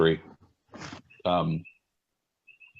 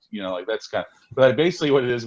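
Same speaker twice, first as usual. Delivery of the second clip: ordinary talk, nothing marked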